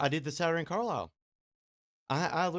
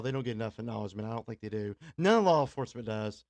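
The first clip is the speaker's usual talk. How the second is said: ordinary talk, nothing marked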